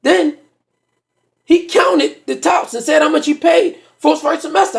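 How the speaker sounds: loud, high excited storytelling; excited shouting. Real, not acted. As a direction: build to a shout at the punchline.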